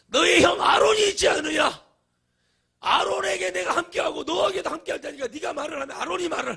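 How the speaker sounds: booming high and loud divine rebuke; angry God. Retold or imitated. Preacher acting God scolding Moses, loud and high. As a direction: thunder at him like an exasperated God. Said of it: "주님이 너무 화가 나셔서".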